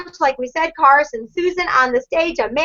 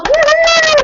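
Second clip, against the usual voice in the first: high loud celebratory woohoo; cheer; 13 semitones higher, 6 dB louder than usual. Real, not acted. Leader cheering her gold medalist, loud woohoo. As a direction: let out a big woohoo. Cheering recognition on team call.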